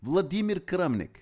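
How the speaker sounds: proud Russian-style name; Russian pronunciation. Shown as a demonstration. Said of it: "I could go all day".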